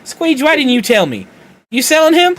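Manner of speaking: high, loud shocked accusing yell; hammed-up disbelief at the stoner news; mock outrage. Boss yelling at Squeege in mock outrage. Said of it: "And they're like".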